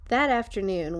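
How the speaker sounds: plain reading voice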